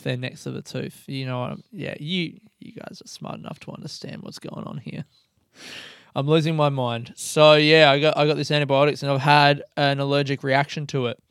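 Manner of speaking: normal talking voice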